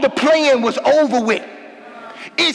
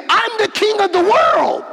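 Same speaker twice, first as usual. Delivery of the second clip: emphatic shout; 9 semitones higher than usual. Retold or imitated. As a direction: proclaim it like a king. Jesus' emphatic claim voiced.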